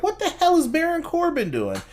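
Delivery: very high-pitched incredulous outcry; baffled disbelief. Retold or imitated. Viewer seeing Baron Corbin stop Asuka climbing, high incredulous mock outcry.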